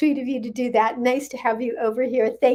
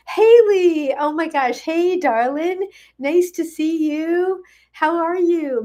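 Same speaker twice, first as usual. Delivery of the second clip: much higher and louder, slow and gushing; delighted greeting; 7 semitones higher, 4 dB louder, slower than usual. Real, not acted. Host greets friend Haley in the live chat, high delighted gush.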